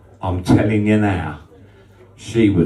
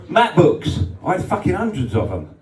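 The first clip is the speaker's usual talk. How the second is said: ordinary talk, nothing marked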